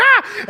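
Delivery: sudden shriek, pitch flying up; high-pitched scream. Retold or imitated. Pastor retelling the janitor scare, a startled shriek. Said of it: "I was like".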